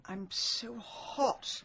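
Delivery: very slow, soft, drugged words; faint drowsy murmur. Acted. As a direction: fight to stay awake. Drugged victim growing drowsy.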